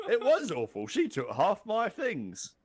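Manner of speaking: high, drawn-out wail in mock English accent; English crocodile jailer. Crocodile moaning about his ex-wife, high put-on English accent. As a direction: complain with comic hurt in an English accent. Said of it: "I don't know why he's English now".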